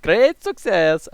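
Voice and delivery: put-on slow, drawn-out Borat accent, falling at the end. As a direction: ham up a Borat impression, stretching each word. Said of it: "And I lost the accent".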